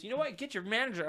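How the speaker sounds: high demand. Retold or imitated. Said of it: "And he goes".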